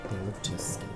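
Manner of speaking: ordinary delivery, nothing marked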